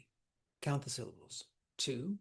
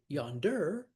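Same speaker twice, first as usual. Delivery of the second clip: "yonder" stressed on the last syllable, yon-DER; "yonder" with the weight wrongly on "-der". Shown as a demonstration. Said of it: "make sure that the stress is where the stress is".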